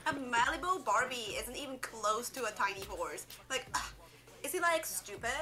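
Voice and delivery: put-on bubbly sorority girl whine; valley girl drama, upspeak; Valley Girl accent. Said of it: "my Valley Girl accent".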